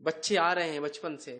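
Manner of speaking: usual speaking voice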